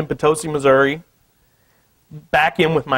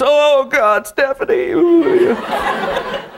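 high, loud mock swoon; 12 semitones higher, 3 dB louder, much slower than usual. Artist carving her print, high mock swoon. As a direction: sigh out her name, lovestruck and hammed-up.

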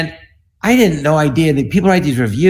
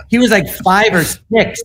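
laughing; 6 semitones higher, slower than usual. Real, not acted. Laughing in disbelief.

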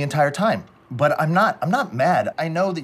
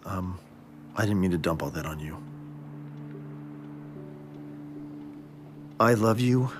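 lower, softer, subdued plea; quiet apology; 8 semitones lower, 8 dB quieter than usual. Acted. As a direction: pull it all back down, pleading. Calmer apologetic voicemail after outburst.

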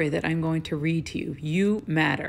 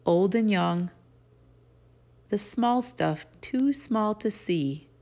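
reading aloud; 5 dB louder than usual. Acted. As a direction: read each line gently with pauses. Reads picture book aloud.

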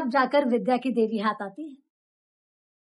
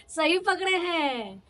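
drawn-out, higher, teasing delivery; playful catchphrase; 4 semitones higher, slower than usual. Acted. Mentor approves of the mirror as best friend, playful drawl. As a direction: stretch each word slowly, pitched up.

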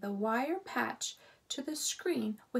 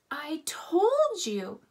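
slow, loud, exasperated; impatient; 6 semitones higher, 6 dB louder, slower than usual. A narrator's reading. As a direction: spell it out, tired of repeating. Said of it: "said Ralph impatiently".

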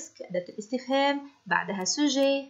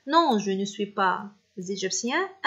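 slow dictation; much slower than usual. Shown as a demonstration. Teacher dictating French answer.

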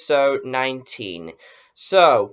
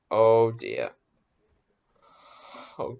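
normal talking voice

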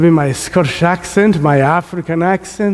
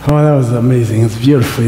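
ordinary delivery

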